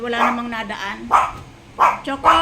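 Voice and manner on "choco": loud, higher shout of the name; calling the dog. Real, not acted. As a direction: call the dog off sharply.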